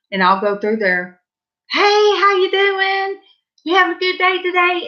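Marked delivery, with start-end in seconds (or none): 1.7-4.9 much higher, sing-song hello to a stranger; upbeat shop greeting, pitched well up; cheery greeting. Retold or imitated. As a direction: sing out a friendly hello to make him smile. Cheery greeting to grocery man.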